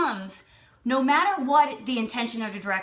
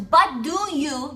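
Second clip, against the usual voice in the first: slow, louder, pressing challenge; aggressive; 3 semitones higher, 5 dB louder, slower than usual. Retold or imitated. Stranger pressing woman about wanting a baby, aggressive. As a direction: push the question aggressively, word by word. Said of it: "now she's getting aggressive with me".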